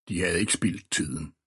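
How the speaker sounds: ordinary delivery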